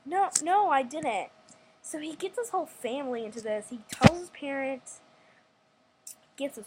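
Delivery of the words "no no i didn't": louder, higher insistent no-no, I didn't; indignant denial. Retold or imitated. Girl shouting back at boy who says she stole his bike, indignant. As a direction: protest hotly, voice jumping up. Kid denies stealing bike.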